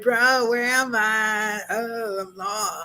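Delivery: slow, stretched California dude talk; lost California bro, vowels dragged out; forced California accent. Acted. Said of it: "trying to force a California accent".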